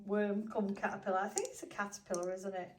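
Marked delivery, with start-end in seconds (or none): none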